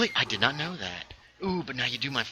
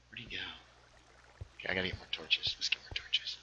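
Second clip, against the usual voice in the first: whispering; 7 semitones lower, 13 dB quieter than usual. Real, not acted. Quiet mutter to self in game.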